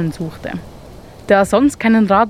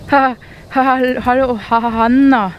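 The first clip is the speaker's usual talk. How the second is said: higher, stammering in surprise; startled stutter; 5 semitones higher than usual. Acted. Mole stammers a startled hello to the hare. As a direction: stumble over the words, startled. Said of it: "stotterte Marvin ganz überrascht".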